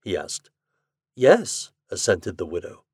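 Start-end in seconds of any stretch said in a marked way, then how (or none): 1.2-1.7 high, louder, mildly surprised; slight surprise. A narrator's reading. Widow answering Ford about the photograph, slightly surprised. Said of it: "Her tone showed slight surprise".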